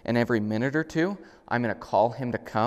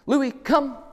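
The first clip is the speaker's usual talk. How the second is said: much higher and louder, crisp; recall command; 6 dB louder than usual. Shown as a demonstration. Trainer calls the dog on the long lead, loud and clear. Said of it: "So when I call him".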